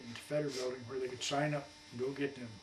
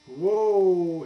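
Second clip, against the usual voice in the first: a sudden, much louder burst of shock; startled whoa; 5 semitones higher, 17 dB louder than usual. Retold or imitated. Told about Pearl Harbor, he blurts loud surprise.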